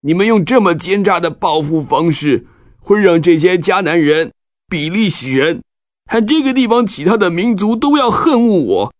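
sad. Acted. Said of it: "难过地对西缅和利未说".

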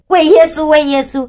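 high, earnest "为耶稣" repeated; 激动地重复; eager insistence. Retold or imitated. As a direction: insist on it happily. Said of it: "我就讲说".

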